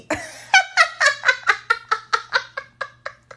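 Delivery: high forced laugh; fake laugh. Shown as a demonstration. Showing friends how to fake-laugh at a joke. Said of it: "Do this like me".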